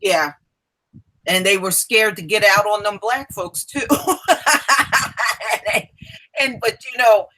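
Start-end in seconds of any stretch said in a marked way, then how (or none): 3.9-5.8 high, rolling cackle of amusement; long run of high giggling laughter; hearty laugh. Real, not acted. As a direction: laugh at your own joke. Laughs at own joke.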